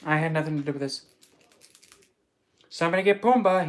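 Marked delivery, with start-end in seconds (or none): 2.7-3.7 loud, higher call for help; raised, urgent play-acted shout; mock alarm call. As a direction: call out in pretend panic. Acted alarm, pig off a cliff.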